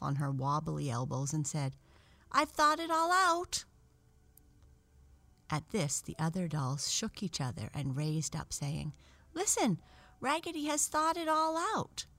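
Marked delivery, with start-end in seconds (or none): 2.3-3.7 high, loud doll's cry; excited announcement. A narrator's reading. Raggedy Ann announcing to the dolls, excited cry. Doll announces her idea at last. 9.3-12.1 high-pitched, eager doll voices; excited announcement. A narrator's reading. Dolls rousing each other with news, excited and high.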